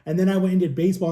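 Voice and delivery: normal talking voice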